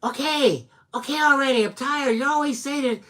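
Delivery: mock a fed-up daughter rolling her eyes; exasperated daughter. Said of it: "Ariel was like".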